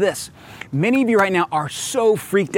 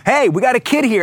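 calling out; 7 semitones higher than usual. Retold or imitated. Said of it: "we just start going".

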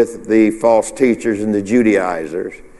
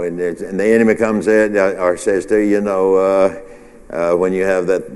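normal talking voice

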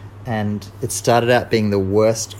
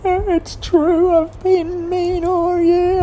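much higher, slow crying wail; Kat in tears; 3 dB louder, much slower than usual. A narrator's reading. Kat admitting fault, high and weepy. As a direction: wail it out as a weeping cat. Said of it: "And Kat burst into tears".

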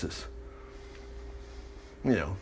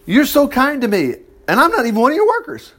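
raised and lifted, quicker on the second half; rereading with more feeling; 7 semitones higher than usual. Retold or imitated. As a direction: read it again higher, as if moved. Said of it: "You are so good to me".

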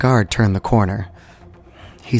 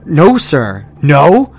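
flustered guard; 5 semitones higher, 5 dB louder, slower than usual. Acted.

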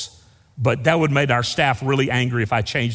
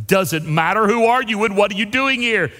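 raised, insistent sentry challenge; voice from darkness; 6 semitones higher than usual. Acted. Unseen voice pressing the rabbi, acted insistent demand. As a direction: brush off the question and demand an answer. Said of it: "The voice goes".

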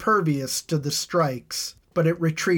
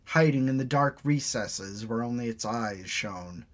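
usual speaking voice

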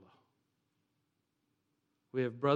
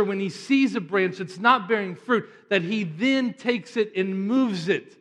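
loud, higher-pitched pulpit delivery; forceful preaching; 6 semitones higher, 12 dB louder than usual. Real, not acted. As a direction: drive the point home from the pulpit.

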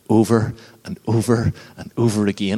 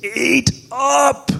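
screamed. Retold or imitated. Father screaming at his slow-eating three-year-old daughter, high sharp yell. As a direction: yell it in a total loss of temper. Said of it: "and I screamed in her face".

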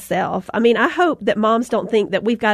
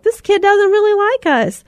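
higher, mock-wounded whine; hurt parent; 9 semitones higher, 3 dB louder than usual. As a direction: voice the rejected feeling, pitched up. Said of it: "we kinda felt like we were rejected".

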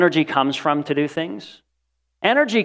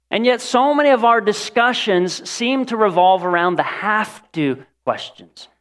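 ordinary talk, nothing marked

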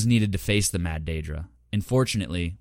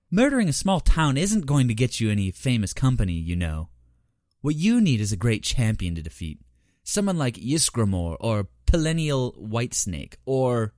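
higher, teasing female daedra; Horavatha voice; 5 semitones higher, 3 dB louder than usual. Acted. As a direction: toy with him in a lilting voice. Horavatha taunting Vernakis.

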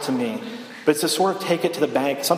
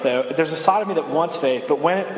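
normal talking voice

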